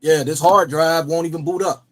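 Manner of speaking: normal talking voice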